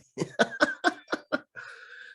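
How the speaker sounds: high airy laugh; breathy laugh. Real, not acted. Laughs after sign-off.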